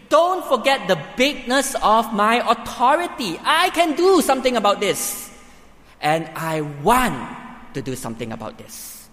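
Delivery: commanding Jesus. Acted. Preacher voicing Jesus declaring authority over death, commanding. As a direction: proclaim it with full authority. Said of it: "But Jesus says".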